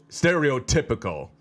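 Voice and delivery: plain reading voice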